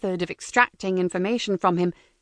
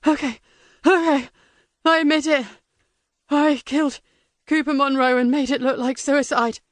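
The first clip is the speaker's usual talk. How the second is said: high, frantic prisoner confessing; desperate captive blurting it out; panicked prisoner; 4 semitones higher than usual. Acted.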